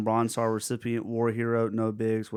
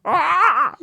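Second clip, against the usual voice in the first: high, loud mock yell "Ah!", louder each time; looping yell; 9 dB louder than usual. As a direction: repeat the skipping scream. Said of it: "went back".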